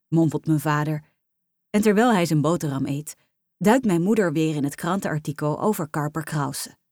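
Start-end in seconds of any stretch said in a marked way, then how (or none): none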